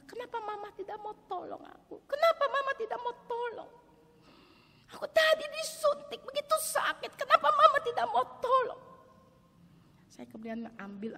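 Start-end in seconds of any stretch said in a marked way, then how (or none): 0.1-2.1 put-on child's plea. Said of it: "seakan dia mau berkata". 2.1-8.8 put-on high, loud child pleading; hurt child's cry. Hurt child asks mama why she didn't help, high and loud. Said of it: "seakan dia mau berkata".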